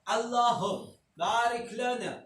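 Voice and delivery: put-on slow Arabic prayer in a light Turkish accent; lightly imitated Turkish accent on the prayer. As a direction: recite it as a Turk reading Arabic, slowly.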